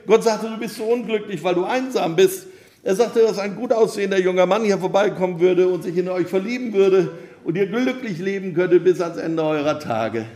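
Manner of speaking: high, loud, breezy rattle; calling back while running. A narrator's reading. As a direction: call it back on the run. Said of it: "rief er im Laufen zurück".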